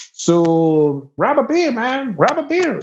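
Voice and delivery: high pitched, slow offer. Retold or imitated. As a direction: push the beer on him like a rowdy host. Redneck offers beers.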